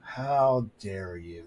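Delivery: low, soft mock indignation; mock offence. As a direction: play wounded, slow and hushed on the repeat. Mock offence at age remark.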